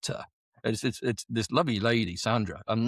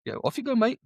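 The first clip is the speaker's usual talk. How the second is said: breezy boss; 12 semitones higher than usual. Retold or imitated. QM shooing him along.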